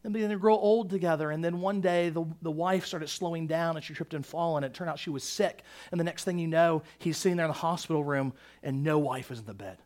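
low, hushed storytelling; quiet, grave account of a death; somber retelling. Real, not acted. Retells movie's sad story of loss.

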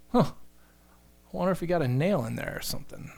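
softly wondering to oneself, mock-puzzled; imagined aside, kept soft; musing disciple. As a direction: voice a disciple's quiet, idle speculation. Voicing a disciple's imagined thought.

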